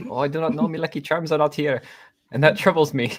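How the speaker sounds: mock Irish accent. Irish catchphrase after accent demo.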